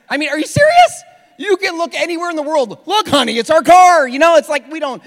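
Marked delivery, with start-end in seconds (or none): 0.1-2.9 much higher and louder, disbelieving; incredulous. Real, not acted. Pastor mocking people who search their own address, incredulous. Incredulous at Google Earth users. 2.9-4.1 higher and louder, hammed-up thrill; mock excitement. A mock husband excitedly showing his wife their car, hammed up. Mocking a spouse on Google Earth.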